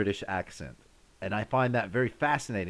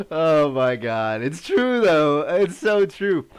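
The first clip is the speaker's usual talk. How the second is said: loud, high, slowly stretched exclamations; helpless amusement; 6 semitones higher, 9 dB louder, slower than usual. Real, not acted. Streamer recovering from accent joke, loudly amused. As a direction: wail it out, worn out from laughing.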